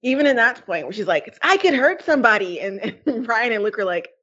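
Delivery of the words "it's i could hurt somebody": high, mock-anguished warning; hammed-up imitation of someone fearing herself; dramatic. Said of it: "dramatic, like".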